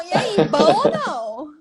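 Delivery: slow southern Brazilian "bom ou não?" rising at the end; southern greeting intonation. Shown as a demonstration. Teacher showing how people in the South greet each other. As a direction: show the southern greeting tune, drawn out. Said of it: "como a gente se cumprimenta lá, lá no Sul".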